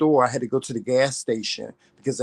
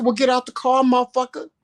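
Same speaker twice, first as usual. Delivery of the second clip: retold shouted dare; 11 semitones higher than usual. Daring the driver to get out, high shouted taunt. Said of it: "I said".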